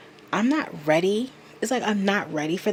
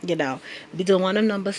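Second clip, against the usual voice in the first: usual speaking voice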